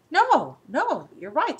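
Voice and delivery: ordinary talk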